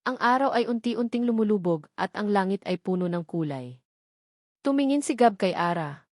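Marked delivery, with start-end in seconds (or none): none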